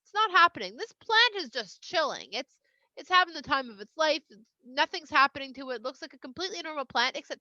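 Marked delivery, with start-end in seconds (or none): none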